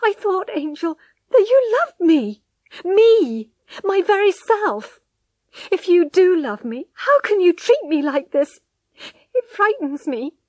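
high, loud, impassioned appeal, stretching key phrases; desperate pleading. A narrator's reading. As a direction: plead desperately for his love. Wife begs husband in anguish.

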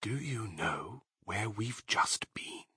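slow, very soft conspiratorial murmur; dark whisper. A narrator's reading. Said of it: "whispered darkly".

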